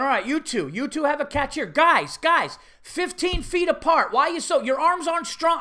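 loud, high coach hollering; coach in go mode. Retold or imitated. Coach drilling second graders on catch, loud go mode. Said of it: "the coach is in go mode".

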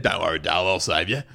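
low, laid-back bloke voice; Man Cam voice. Retold or imitated. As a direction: drop low like a heroic Aussie bloke. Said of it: "Man Cam has a lower voice".

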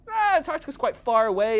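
mock God brushing aside the angels; breezy dismissal. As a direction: wave the idea off lightly.

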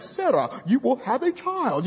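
a lightly done deep, hushed prophecy voice, a hint of Obi-Wan Kenobi; mysterious stranger's accent. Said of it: "I can imagine these strangers have this accent".

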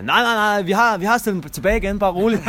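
put-on high, loud, laughing drunk reassurance; drunken shout. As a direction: yell cheerfully at the cop that it's all fine. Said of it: "Så råber han til politimanden og siger". (højt, fuldt og grinende råb til betjenten)

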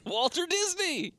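high and slow, put-on; high drawn-out voice. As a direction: stretch the name up high. Repeats name in put-on voice.

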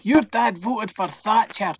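put-on high taunting kid's shout. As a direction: yell it like a schoolyard insult. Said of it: "one of the kids shouts".

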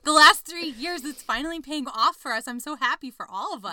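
loud, high, thrilled; excited. Real, not acted. Cheering fellow Capricorns about their payoff year, loud and high. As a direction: celebrate the good news out loud.

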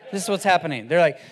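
ordinary delivery

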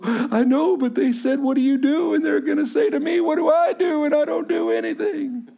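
very high mock-sobbing wail; acted meltdown. Acts out his meltdown to wife.

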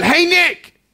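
high, loud call across the schoolyard. Retold or imitated. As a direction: shout his name to catch his attention. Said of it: "who yells out".

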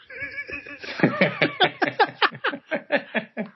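big high burst of laughter. Real, not acted. Laughing at game name.

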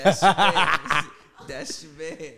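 drawn-out words through laughter; laughing taunt. Real, not acted. Teasing co-host whose man got dunked on, laughing. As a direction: tease them while cracking up.

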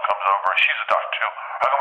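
normal talking voice

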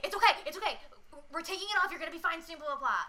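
hurried soothing to a patient; lightly urgent calming; urgent reassurance. Shown as a demonstration. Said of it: "something with urgency".

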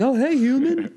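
very high, gushing hello; delighted greeting. Retold or imitated. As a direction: greet a person as you would a dog. Acts out delight at meeting a person.

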